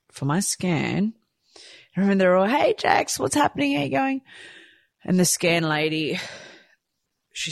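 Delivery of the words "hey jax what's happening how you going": much higher, breezy hello; chirpy greeting. Retold or imitated. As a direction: sing out a friendly hi. Staff greeting her, quoted.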